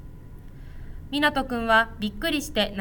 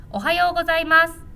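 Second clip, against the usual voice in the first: bright, louder cheerful good morning; cheerful greeting; 5 semitones higher, 5 dB louder, faster than usual. A narrator's reading. Principal greets shy child with a smile, cheerful. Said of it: "園長先生が笑顔で".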